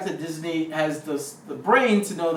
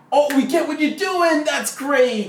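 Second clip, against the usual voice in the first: delighted audience; 8 semitones higher, 4 dB louder, slower than usual. Retold or imitated.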